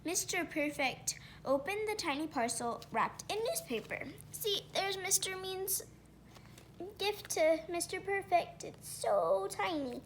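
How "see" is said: usual speaking voice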